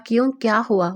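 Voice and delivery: ordinary delivery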